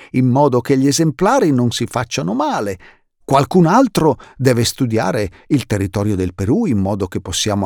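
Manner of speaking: high, loud, busy explorer chatter; listing the jobs with gusto; lively explorer uncle, eager; enthusiastic uncle. Acted. Uncle Monty explains the plans.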